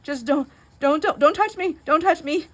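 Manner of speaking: high, loud frantic don't touch me; panicked pleading. Retold or imitated. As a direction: beg him off in a half-laughing panic. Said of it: "I go".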